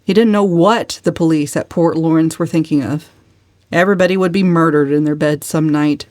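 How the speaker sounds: plain reading voice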